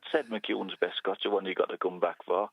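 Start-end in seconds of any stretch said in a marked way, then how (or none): 1.2-2.5 put-on mild Geordie accent on the ad tagline; Geordie advert voice. Listener recalling the lager advert, mild Geordie ad read. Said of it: "a really strong Geordie voice".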